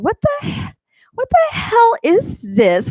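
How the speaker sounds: high-pitched, drawn-out mock bewilderment; baffled disbelief. Streamer retelling first seeing ASMR, mock baffled.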